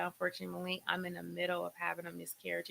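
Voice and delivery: subdued bad news. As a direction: break sad news softly. Retold breaking of bad news.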